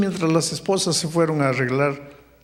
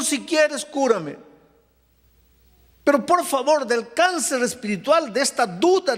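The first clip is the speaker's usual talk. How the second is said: higher, fervent pleading to God; pleading prayer; 9 semitones higher than usual. Acted. Doctor praying for healing of his doubt, fervent pleading. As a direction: beg God for healing with full fervour.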